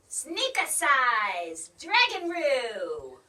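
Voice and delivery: loud, slow incantation; shouted spell. Acted. Mitzi shouting a spell at the storm, slow and loud. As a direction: call out each word of the spell. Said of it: "while Mitzi shouted".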